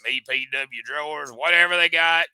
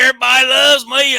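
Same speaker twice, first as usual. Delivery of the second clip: strut in bragging like a vain wrestler; mock Joey Gross; 10 semitones higher, 9 dB louder than usual. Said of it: "walk in the door and go".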